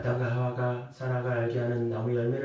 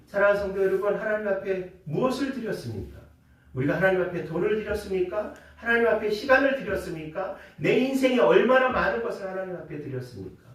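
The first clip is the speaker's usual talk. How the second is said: rising, louder preacher's appeal; preaching; 6 semitones higher, 5 dB louder than usual. Acted. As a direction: press the congregation with each question. Pastor preaching with rising force.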